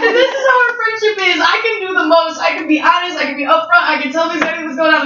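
high, fast excited rant. Real, not acted.

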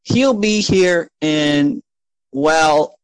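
high, slow, stalling receptionist; Karen. Acted. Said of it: "And she goes".

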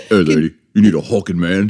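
put-on tough guy hitting on a lady; mock-macho come-on; scary he-man. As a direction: play a hammy, menacing strongman. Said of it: "It's a little better, but still, now I'm scared".

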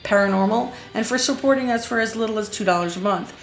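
bright podcast promo voice; podcast outro read. Acted. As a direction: sell the plugs like a host signing off.

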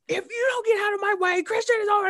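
very high, indignant mock yell; whine-shout at whoever blocks your way; retold hot outburst. Young fan snapping back at blocking wrestler, mock outrage. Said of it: "legit hot".

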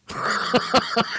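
a sudden high airy giggle; a short high laugh of disbelief. Real, not acted. Laughing at the cashier who can't make change, high and airy.

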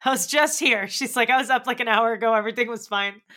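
put-on a kid panicking that everything changed; upset kid.

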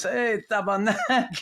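slow, laughing mock call. Defenseman teasing the fallen coach, slow and laughing. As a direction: tease him while laughing. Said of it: "Mark Hardy goes".

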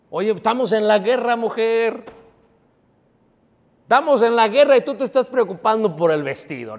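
high, exasperated mock reproach; scolding. Preacher rebukes a woman worried about dresses in war, scolding. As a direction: scold her in disbelief.

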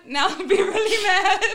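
loud, high laughing taunt. Pretend. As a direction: tease through laughter. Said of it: "Be like".

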